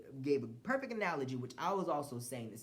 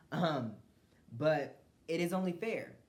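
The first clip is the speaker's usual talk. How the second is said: usual speaking voice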